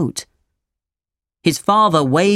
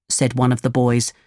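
plain reading voice

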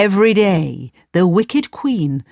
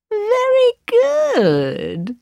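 high, very slow gloating queen; evil queen; much slower than usual. Acted. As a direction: drawl it with cruel delight. Wicked queen receives the heart.

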